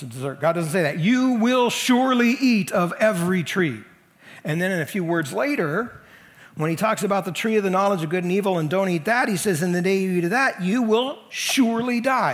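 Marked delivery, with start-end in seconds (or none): 1.0-3.8 loud, high and slow, stressing "surely"; emphatic. Retold or imitated. Preacher voicing God's command to Adam, emphatic. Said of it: "Again, it's emphatic". 9.6-12.3 solemn warning, hammering "surely die"; emphatic. Retold or imitated. God warning Adam about the tree, emphatic. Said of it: "Again, it's emphatic".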